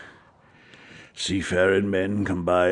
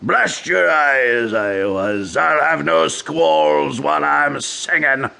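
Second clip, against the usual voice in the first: loud, slow furious snarl; angry shout; 4 semitones higher, 4 dB louder than usual. Acted. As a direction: bark it at the doctor. Captain quarrels with the doctor.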